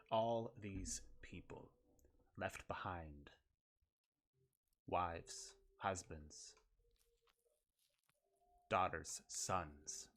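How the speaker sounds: quiet, bitter villain speech. Acted.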